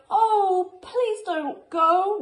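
high, loud begging; pleading cry. Acted. Wild things beg Max to stay, high loud pleading. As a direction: beg him not to leave. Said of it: "the wild things cried".

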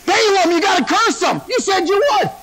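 high, insistent mock protest; acted demanding king. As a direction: press the prophet as the exasperated king. Balak demanding the promised curse.

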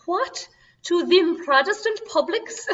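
high, loud indignant Irish brogue; Irish maid's accent. Retold or imitated. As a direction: play the outraged Irish maid, in brogue. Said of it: "you can hear my accent".

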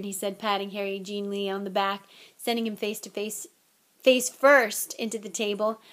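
ordinary delivery

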